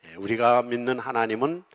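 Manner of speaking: ordinary talk, nothing marked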